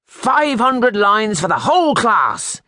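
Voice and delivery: high, loud, slow furious shout; the master shouts at the rowdy class. A narrator's reading. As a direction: punish the whole class in rage. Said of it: "shouted in a furious voice".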